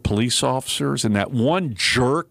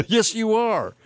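much higher taunting retort; mock troll reply; 9 semitones higher, slower than usual. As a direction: play the smug tweeter. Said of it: "the socialist part".

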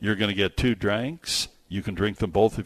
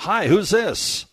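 high, slow, loud on-air hello; bright radio greeting; 11 semitones higher, 5 dB louder, slower than usual. Acted. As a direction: greet the caller like a DJ.

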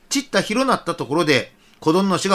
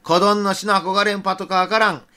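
ordinary talk, nothing marked

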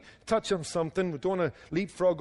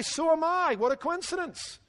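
high-pitched, eager discovery of common ground; mock delighted surprise; 12 semitones higher than usual. Rescuer to man on the bridge in a joke, mock delighted. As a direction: play up the happy coincidence, much higher.

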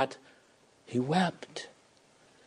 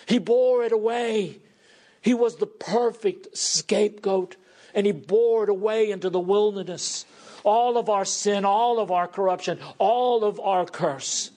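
fervent preaching; 5 semitones higher than usual. Acted. Preacher proclaiming the sacrifice to his congregation, fervent. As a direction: drive each "all" home to the congregation.

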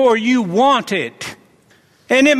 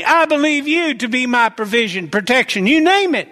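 ordinary talk, nothing marked

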